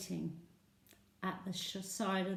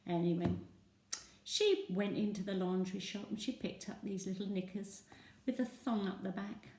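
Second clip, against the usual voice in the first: ordinary delivery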